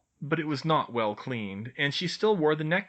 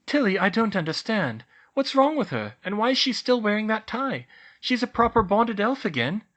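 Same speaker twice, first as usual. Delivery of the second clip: Hermione voice; 10 semitones higher, 6 dB louder than usual. A narrator's reading. Hermione puzzled.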